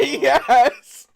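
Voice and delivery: loud, high, laughing yes; gleeful yes. Pretend. Game master overrules a protesting player, gleeful shout. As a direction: crow it over their protest.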